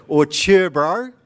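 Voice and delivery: high, loud cheer, bro; demo Kiwi slang. Shown as a demonstration. Kiwi speaker teaching slang to the audience, loud high demo. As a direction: call out cheer, bro like a laid-back local. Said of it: "That means".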